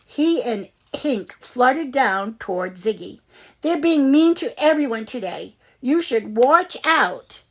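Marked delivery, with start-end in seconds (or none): 3.6-7.4 warning friend. A narrator's reading. Woodpecker warning.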